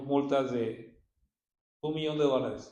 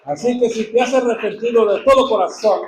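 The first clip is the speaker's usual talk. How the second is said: loud, high exultant proclamation; preaching at full force; 3 semitones higher, 12 dB louder than usual. Acted. Pastor exhorting the congregation to repent, loud and exultant. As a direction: lift the church with a shout of glory.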